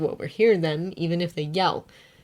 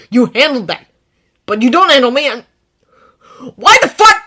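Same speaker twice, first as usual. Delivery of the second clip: angry; 7 semitones higher, 13 dB louder than usual. A narrator's reading. Bakugo shouting.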